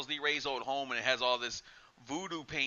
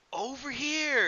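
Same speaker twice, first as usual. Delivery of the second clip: creepy doll call; 10 semitones higher, slower than usual. Retold or imitated. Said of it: "looking around".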